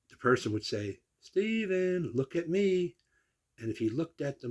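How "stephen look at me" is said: put-on coaxing a child. Tester calling toddler's attention.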